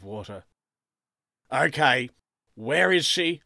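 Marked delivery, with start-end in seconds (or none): 1.5-3.4 loud, higher demand; interrogation-like. A narrator's reading. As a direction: question him like a police sergeant. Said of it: "interrogation-like".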